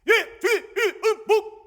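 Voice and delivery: shouted soldier's name and rank report; korean military style. Retold or imitated. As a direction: bark it out like a private at attention. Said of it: "관등성명이라는 걸 내야 돼".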